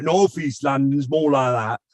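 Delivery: put-on slow, broad North East London accent. Touring Europe in accents for the show host, North East London accent. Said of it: "do the tour of Europe".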